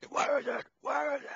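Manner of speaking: imitated yelling in a forced gruff voice. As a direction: rasp out a high, straining demand. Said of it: "the whole gruff, uh, voice thing".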